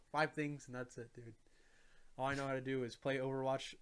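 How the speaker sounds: usual speaking voice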